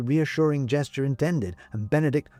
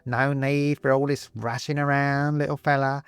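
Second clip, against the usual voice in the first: slow, higher, would-be soothing skeleton voice; 4 semitones higher, slower than usual. Acted. Skeleton calming the frightened Benedict, mock soothing voice. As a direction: try to soothe, but it comes out creepy. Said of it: "probably supposed to be a soothing tone".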